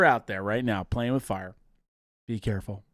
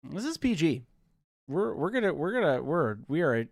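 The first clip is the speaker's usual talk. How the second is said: ordinary talk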